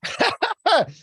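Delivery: high-pitched burst of laughter; amused laugh. Real, not acted. Amused at calling Artem behind the camera, high laugh.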